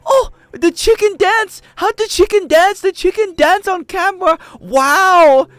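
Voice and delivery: put-on very high, loud, slow amazed old lady; grandma watching the AI chicken dance, wide-eyed and squeaky; imitating grandma. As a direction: marvel like a baffled grandmother. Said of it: "she scrolls one more".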